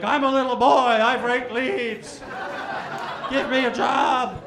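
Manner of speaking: put-on high, loud, slow childish plea; mock little-boy plea. Boy asking the unemployment office for a job, high, loud and slow. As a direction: beg like an earnest little kid.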